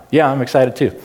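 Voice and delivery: excitement that fails to come out; low-key attempt at excitement. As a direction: try to sound excited and stay completely even. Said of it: "It just doesn't happen".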